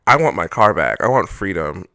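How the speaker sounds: ordinary talk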